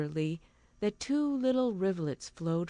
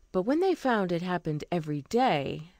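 plain reading voice

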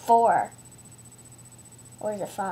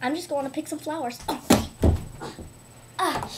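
ordinary delivery

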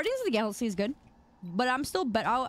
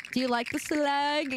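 ordinary delivery

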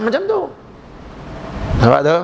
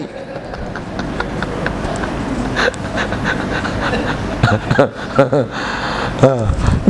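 long laugh; 6 semitones lower than usual. Real, not acted. Preacher laughs at own joke.

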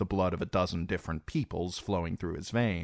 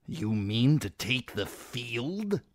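slow, doubtful question; a touch of disbelief; disbelieving; 5 semitones higher, slower than usual. Acted. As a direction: ask as if she can't mean it. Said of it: "voice was thick with disbelief".

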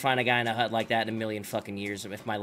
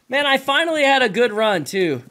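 frustrated; 10 semitones higher, 8 dB louder than usual. Real, not acted. Lamenting lost run.

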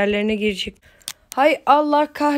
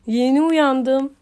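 drawn out slowly, sleepy, rising at the end; slow groggy just-woke-up voice; 5 semitones higher, 4 dB louder, much slower than usual. Real, not acted. To viewers, explaining he just woke up, slow and groggy. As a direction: stretch it out like you're barely awake. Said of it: "Hem bir sesim bir garip oluyor sabahları".

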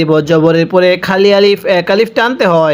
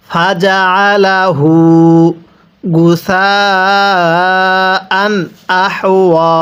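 slow drawn-out tajweed chant; Quran recitation; much slower than usual. Shown as a demonstration. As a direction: stretch every vowel like a teacher reciting. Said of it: "আমার সাথে পড়ুন".

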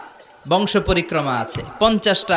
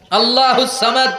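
high, short proclamation of the verse; spoken declamation; 5 semitones higher, 4 dB louder than usual. Shown as a demonstration. Preacher quoting the verse before translating it, spoken declamation.

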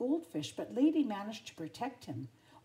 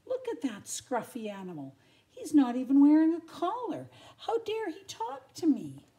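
haughty, rising to high indignation; a proud pet offended by a stray; indignant lady dog; 6 semitones higher, 9 dB louder than usual. Acted. Pampered dog snubbing a stray, indignant.

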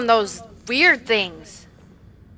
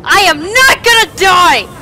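defiant shout; 6 semitones higher, 7 dB louder than usual. Real, not acted. Fleeing monster in game.

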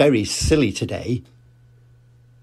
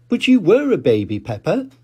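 lightly voiced gentle mother; a hint of a patient mum; Mummy Pig; 6 semitones higher, 3 dB louder than usual. Mummy Pig gently correcting Peppa. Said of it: "said Mummy Pig".

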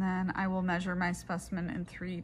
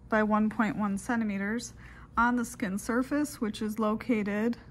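dictating. Shown as a demonstration. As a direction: dictate it clearly into the recorder. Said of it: "I will go ahead and do those steps in a dictation format".

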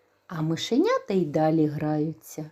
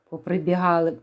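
usual speaking voice

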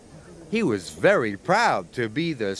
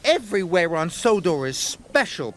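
peeping; 4 semitones higher than usual. A narrator's reading. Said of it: "peeped Percy".

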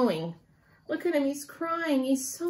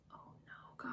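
hushed dismayed whisper; soft whisper of bad news; 27 dB quieter than usual. Real, not acted. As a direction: breathe it out in dismay. Sad twist told to child.

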